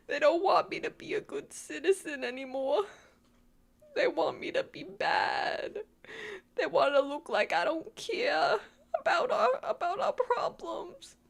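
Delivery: high, hammed-up New York accent; mock-tearful New Yorker with a high-pitched accent. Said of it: "I was doing the New York accent earlier".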